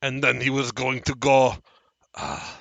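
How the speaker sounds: loud declaiming with a halting search for words; strange deranged man. Acted. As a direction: proclaim it, falter, then resume loudly. Strange man reports dwarf's plan.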